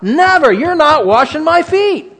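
high, loud, slow outburst of protest; shocked refusal. Acted. Peter recoiling from Jesus, loud high protest. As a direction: cry it out, pulling your feet away. Peter pulling his feet away.

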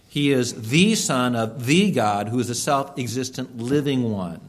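ordinary talk

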